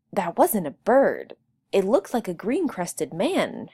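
ordinary delivery, nothing marked

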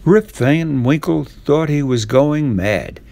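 usual speaking voice

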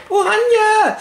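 very high, loud whoop; rallying cry. Pretend. Friends rally for the Netherlands, very high loud cheer. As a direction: cheer it like a football chant.

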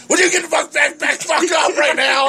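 high mock shout of a drunk old man; imitating a drunk yelling. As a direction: yell it like the drunk at the ATM. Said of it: "He goes".

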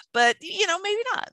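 ordinary delivery

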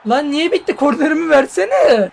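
high, loud exasperated complaining; angry at the game. Real, not acted. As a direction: rant at the game for stealing your corner. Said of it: "Manyak sinir ediyor beni". (yüksek sesle, sinirli şikâyet)

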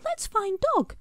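very high, bright little voice; a small character keen to set off; eager Scribble. A narrator's reading. Scribble urging Smudge to go find Dog, high.

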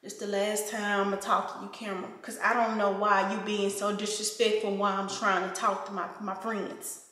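hushed, pretend-stern reprimand; mock final warning. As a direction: quietly tell off the camera, fed up.

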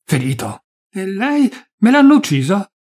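the wife crying out in panic; rising panic. Acted. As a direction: let the fear break through. Wife panics over wounded husband.

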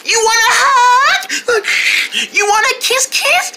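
high sweet-talking coax. Real, not acted. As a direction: coax a pet in a high sing-song. Coaxing the dog.

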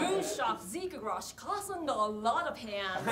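slow fake English with a lot of stressed; mock English with feeling. Shown as a demonstration. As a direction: lean on a lot of. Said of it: "気持ちを込めて言ってみてください".

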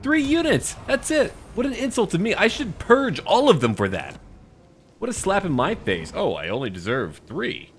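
high shrill indignant ranting; mock outrage. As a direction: play it hammy and offended. Mock outrage at three units.

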